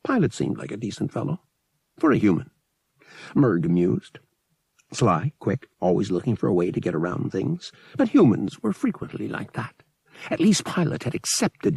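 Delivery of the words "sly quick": usual speaking voice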